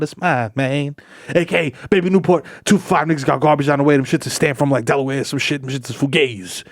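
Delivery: ordinary talk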